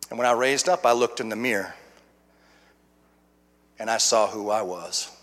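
low, soft and weighted, with long pauses; hushed, solemn testimony. Real, not acted. Preacher recalls seeing himself in the mirror, hushed and solemn. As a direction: let the confession drop low and quiet.

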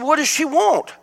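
high-pitched exasperated question; retold irritated reply. Preacher retells answering his wife, irritated.

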